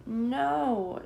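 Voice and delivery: quick high shout of no; children shouting. A narrator's reading. Children answering the puppet king with a shout. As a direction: fling the answer back. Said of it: "shout the children".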